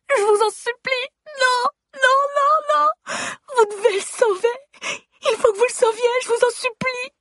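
shrieking high pleas through tears; sobbing scream. Acted. Wife screaming and crying to doctors to save her husband. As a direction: beg at the top of your lungs, crying. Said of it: "Je hurle".

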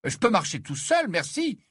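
put-on boy irritably refusing to be led; irritated protest. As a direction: brush him off, annoyed. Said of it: "protesta Harry d'un ton irrité".

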